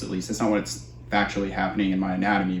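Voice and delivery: normal talking voice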